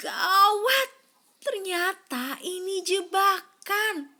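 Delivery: gasp, then high, loud alarm. A narrator's reading. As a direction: panic at the trap. Yaki trapped, gasp. (panik dengan suara tinggi dan keras)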